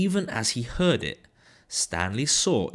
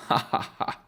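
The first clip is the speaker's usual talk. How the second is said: gloating laugh. Acted. Mister Dart laughs at joke.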